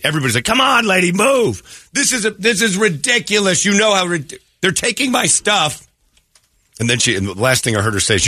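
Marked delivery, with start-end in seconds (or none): 0.4-1.9 high, mock exasperated call; impatient crowd. Retold impatient queue at woman. 1.9-5.9 high-pitched imitation of an outraged customer; mock indignant fit. Retold woman's indignant fit.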